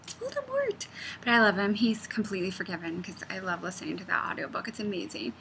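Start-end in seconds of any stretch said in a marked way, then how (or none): 0.0-0.9 very high squealed fake indignation; theatrical high-pitched horror; mock outrage. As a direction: protest in a hammed-up, squeaky falsetto. Acts out outrage at the mispronunciation.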